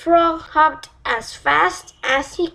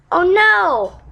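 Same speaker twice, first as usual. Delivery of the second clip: groaning; 7 semitones higher, 5 dB louder than usual. Acted. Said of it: "Poppy groaned".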